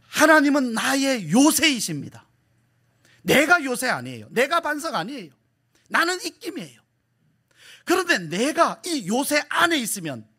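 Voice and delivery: high-pitched, insistent preacher's declarations; impassioned preaching. Real, not acted. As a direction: drive each contrast home to the congregation. Preaches with force.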